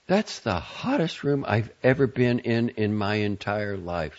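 ordinary delivery, nothing marked

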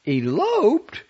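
loud, high cry of disbelief; shocked exclamation. A narrator's reading. Guest hearing of the elopement, shocked.

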